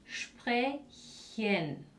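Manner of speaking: "sprechen" said "shprechen", ch like a soft J; German sprechen. Shown as a demonstration. Teacher modelling sprechen for Spanish speakers. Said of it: "Y ese C H es como una J muy suave".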